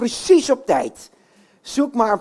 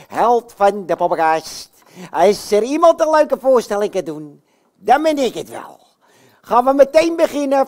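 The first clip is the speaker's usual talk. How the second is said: Jan Klaassen puppet voice; 3 dB louder than usual. Acted. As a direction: play the boastful puppet hero for kids. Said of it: "ik ben namelijk de enige, de echte Jan Klaassen".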